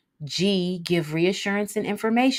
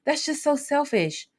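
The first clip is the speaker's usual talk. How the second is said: skeptical objection; 8 semitones higher than usual. Retold or imitated. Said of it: "some of you may be thinking".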